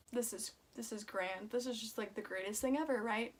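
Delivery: quiet deadpan sarcasm; soft ironic aside about the milk; sarcastic. Retold or imitated. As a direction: mutter it dryly, as if it's grand. Sarcastic retold reaction.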